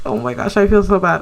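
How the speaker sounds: ordinary talk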